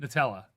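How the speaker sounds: "Nutella" with the first syllable cut to a quick "nuh"; soft, fast "Nutella", reduced first vowel. Shown as a demonstration. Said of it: "I say it very fast".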